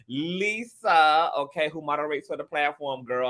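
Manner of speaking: usual speaking voice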